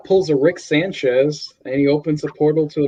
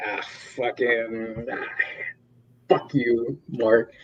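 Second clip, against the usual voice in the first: softer, slow mock groan; a hint of pained grumbling; smacked Mark; 4 semitones lower, 8 dB quieter, slower than usual. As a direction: Mark after the slap, lightly voiced pained.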